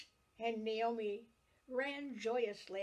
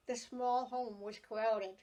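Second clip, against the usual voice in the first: usual speaking voice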